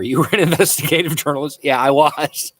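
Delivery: amused read-out and answer, breaking into laughter; laughing. Real, not acted. Laughs reading question about his past.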